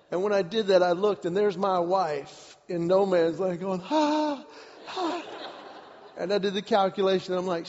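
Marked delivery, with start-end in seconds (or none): 3.8-5.2 high-pitched frightened gasps; imitated panicked panting. Wife stranded in no man's land, high panicked gasps.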